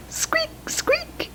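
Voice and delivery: very high, soft squeaky mouse; mouse squeak. Pretend. Reading a board book, tiny high mouse squeak. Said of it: "was his sweet little cry".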